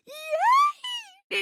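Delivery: very high squeal of glee; gasp, then a tiny high cheer; excited cheer. Acted. Player delighted the scene begins, high excited squeal.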